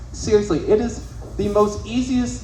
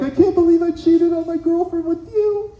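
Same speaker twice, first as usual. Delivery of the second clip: mock crying; 11 semitones higher than usual. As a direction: bawl it out, hammed up. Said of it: "just fucking bent over crying".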